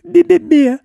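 very high, loud squeaky jeer; squeaked tongue-out teasing; taunting tiny mouse. A narrator's reading. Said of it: "скорчила рожу и показала язык".

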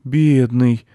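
high, slow, drawn-out pity; boy's pitying cry; distressed sympathy. A narrator's reading. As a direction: draw it out, sorry for the poor animal. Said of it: "огорчился за кабана мальчик".

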